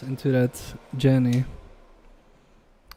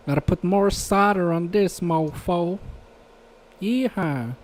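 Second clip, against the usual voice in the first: mock Louisiana accent; 4 semitones higher than usual. Streamer soldering a controller in a joking Louisiana accent. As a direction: ham up a Louisiana good old boy while soldering. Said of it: "I think people with a Louisiana accent".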